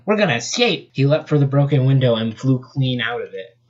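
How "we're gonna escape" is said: high confident shout; excited. Acted. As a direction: call it out boldly. Sonic yells.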